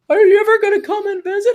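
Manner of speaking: high-pitched, loud mom voice; nagging mom. Acted. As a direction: put on a shrill mom asking after her kid. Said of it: "then your mom".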